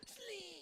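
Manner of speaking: sharp high sliding "slee" like a whistle; high whistle call. Shown as a demonstration. Calling out to stop the toddler, whistle-like high "Slee!". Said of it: "It's like a whistle".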